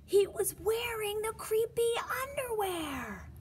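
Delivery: high, eerie whispered reveal; spooky whisper. A narrator's reading. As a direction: hush it like a ghost story twist.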